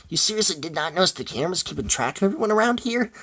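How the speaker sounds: high, gleeful taunting voice; high mocking disbelief after a laughing fit; laughing mockery. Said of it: "He continues to laugh".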